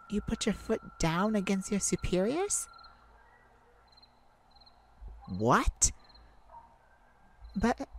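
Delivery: can't believe what she's hearing; shocked disbelief. Acted. Shocked at partner's defiance.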